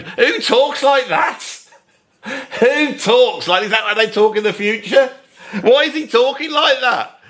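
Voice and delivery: high, incredulous outburst. Real, not acted. Reviewer baffled at the stilted lyric, incredulous. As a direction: hammer it out in disbelief.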